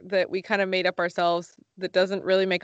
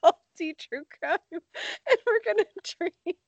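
voice cracking; 7 semitones higher than usual. Real, not acted. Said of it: "why did my voice crack?".

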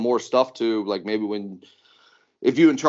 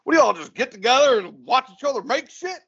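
high-pitched mock bafflement; baffled brother; 13 semitones higher than usual. Brother asking what happens at Maker Camp, baffled and high. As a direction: imitate an incredulous question, pitched way up.

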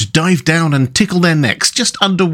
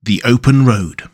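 usual speaking voice

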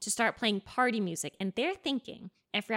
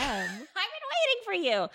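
whiny Rasputin; 8 semitones higher than usual. Retold or imitated. Rasputin complaining.

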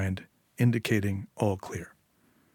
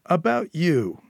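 higher, louder cold scolding boss; menacing gang boss; 7 semitones higher, 3 dB louder, slower than usual. A narrator's reading. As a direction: lecture her like a patient but dangerous boss. Eddie warns Julia.

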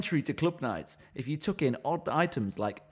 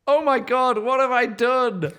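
cringing; 9 semitones higher, 6 dB louder than usual. Acted. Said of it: "I cringed to Reva".

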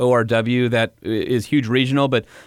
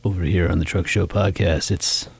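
sexy voice; 8 semitones lower than usual. Pretend. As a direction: drop it low and sultry. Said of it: "that's just a smoker".

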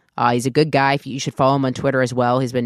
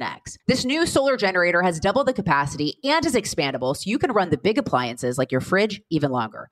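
ad read; 5 semitones higher, 3 dB quieter than usual. Acted. Host reads sponsor ad.

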